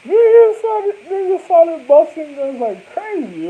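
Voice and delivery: loud, high, slow excited retelling. Real, not acted. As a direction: shout it out, caught up in the story.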